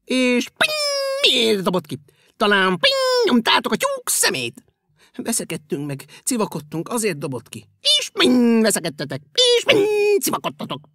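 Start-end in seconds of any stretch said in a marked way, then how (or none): 0.0-4.6 loud, high bouncy voice; a springy creature peering into a bin. Acted. Springy creature asks, says ping. 7.8-10.9 loud, high bouncy voice; a springy creature peering into a bin. Acted. Springy creature teases shoes.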